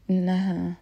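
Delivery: usual speaking voice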